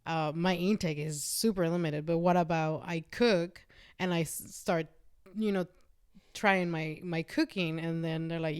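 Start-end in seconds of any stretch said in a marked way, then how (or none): none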